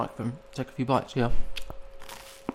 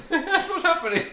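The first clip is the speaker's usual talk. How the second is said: high, squeaky words through laughter; helpless laughter; slower than usual. Real, not acted. Explaining own poster joke, helplessly laughing. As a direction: say it while cracking up. Cracking up after own bit.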